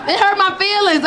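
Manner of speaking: ordinary delivery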